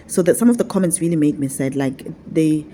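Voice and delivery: usual speaking voice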